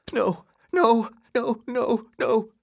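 high, fearful repeated denial; half under his breath. A narrator's reading. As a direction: protest helplessly to yourself. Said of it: "half under his breath".

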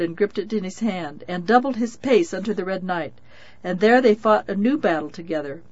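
ordinary delivery

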